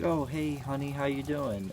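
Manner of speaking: sing-song motherly hello, up high; mom's cheery greeting. Retold or imitated. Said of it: "and she's like".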